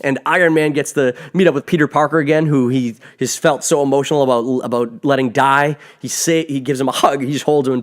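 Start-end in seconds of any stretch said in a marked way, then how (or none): none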